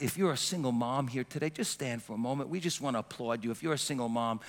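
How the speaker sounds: ordinary delivery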